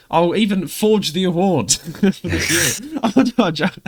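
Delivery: slow, laughing through the words. Real, not acted. Joking about forging the award, laughing. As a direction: giggle it out.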